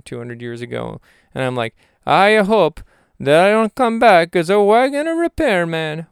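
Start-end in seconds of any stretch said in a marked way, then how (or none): 2.1-6.1 high, loud, slow Italian caricature; mock Italian accent. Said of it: "funny that I think Italian".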